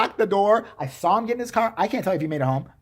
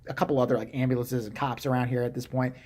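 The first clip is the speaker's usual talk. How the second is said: usual speaking voice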